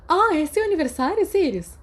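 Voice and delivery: bem mais aguda e mais alta, surpresa; Mary descobre de repente, encantada; surpresa animada. Acted. (Mary surpresa ao saber do aniversário, aguda e alta)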